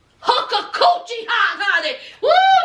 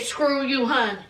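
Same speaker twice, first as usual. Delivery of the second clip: 1 dismissive mama; 5 semitones lower, 4 dB quieter than usual. Retold or imitated. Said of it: "that mama said".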